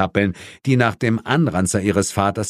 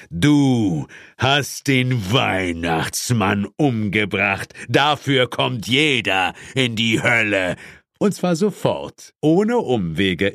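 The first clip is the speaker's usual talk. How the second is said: devil voice. Acted. Devil accuses hero.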